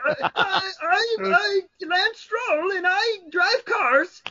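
mock a whiny Canadian kid; high-pitched Canadian voice. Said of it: "his fucking annoying high-pitched Canadian voice".

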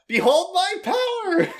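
very high, loud, slow proclamation; megalomaniac rant. Retold or imitated. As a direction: declare it like a mad wizard. Megumin quote, gleeful laughter after.

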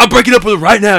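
high, very loud acted outburst; mock loud announcement. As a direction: blurt it out loudly, playing someone else. Acting out a breakup announcement.

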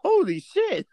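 high, amazed outburst; retold exclamation. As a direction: blurt it out, wowed by the picture. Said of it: "I said".

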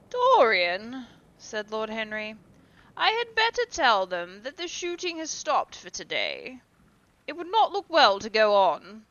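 a hint of a composed aristocrat; lightly voiced Lord Henry. A narrator's reading. Lord Henry speaks, louder voice.

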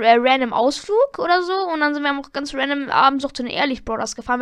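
ordinary delivery

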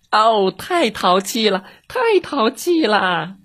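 high, slow fussy lament; cat teacher lament. Acted. Cat teacher running off, high slow lament.

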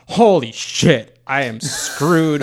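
loud, drawn-out mock horror; hammed-up cry of alarm, dragged out slowly; mock panicked outburst. He imagines dreading his partner's pregnancy news, mock panicked outburst.

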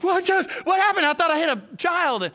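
high, loud alarmed husband; jolted out of his skin, demanding what happened; startled driver yelling back at his wife; panicked reply. Retold or imitated. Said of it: "I'm like".

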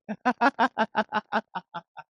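high, long burst of laughter falling away. Real, not acted. Laughing at Peter joke.

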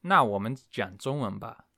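usual speaking voice

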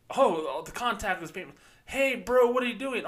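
over-the-top bro greeting; mock bro talk. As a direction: play the dudebro calling out. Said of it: "sounds like Bank of America fed these questions".

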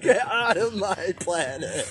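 laughing high Shrek line; laughing mock Shrek. Friends joking, laughing as Shrek. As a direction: giggle through a pretend ogre growl.

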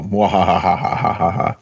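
mad scientist cackle; villainous mwahaha laugh; evil laugh. Shown as a demonstration. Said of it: "That's an evil laugh".

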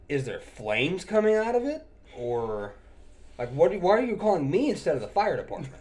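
incredulous. Retold or imitated. Technician questions customer about reported fire, incredulous. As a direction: press the absurd question with mock disbelief.

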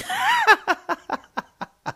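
loud, very high cackling laugh; burst of laughter. Real, not acted. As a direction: crack up at the flub. Laughs at his own slip.

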